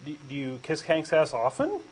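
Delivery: normal talking voice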